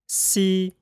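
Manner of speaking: See held high and level, Mandarin first tone. Shown as a demonstration. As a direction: keep See high and flat. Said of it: "One more time".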